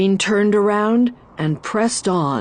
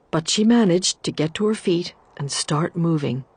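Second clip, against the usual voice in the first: plain reading voice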